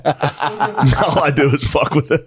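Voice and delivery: ordinary delivery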